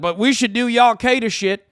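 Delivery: hammed-up jab in a put-on voice; mock evangelical voice. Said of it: "they turn around and they're like".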